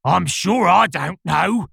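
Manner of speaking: slow, drawn-out lion growl. A narrator's reading. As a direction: growl it out grumpily. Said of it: "the lion growled out".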